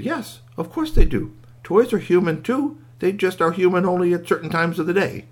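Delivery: high-pitched talking toy, sure of itself; matter-of-fact squeaky toy. A narrator's reading. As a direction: explain it brightly in a high toy voice.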